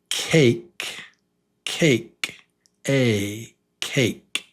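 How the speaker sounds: cake with a long "ay". Shown as a demonstration. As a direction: model cake for the class. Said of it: "the long one is A".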